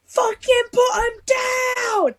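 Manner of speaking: very high, stretched-out mock yell; retold furious shout. Instructor sees her running with caches, high furious shout. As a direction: scream the order slowly, imitating an angry instructor.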